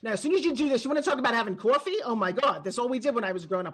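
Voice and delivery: chatty New York accent demo. Shown as a demonstration. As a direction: talk like a native New Yorker about coffee. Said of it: "my original accent comes from New York".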